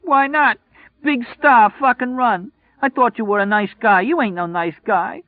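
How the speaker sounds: very high, loud tearful woman; a hurt girlfriend throwing insults through tears; crying insults. Acted.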